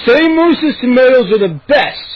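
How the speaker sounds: loud, high bullying command; ordering. A narrator's reading. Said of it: "he ordered".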